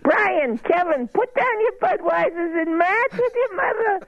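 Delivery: high-pitched Boston mom scolding her sons; Boston mother. Retold or imitated. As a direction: nag the boys in a thick Boston accent. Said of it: "You got the accent".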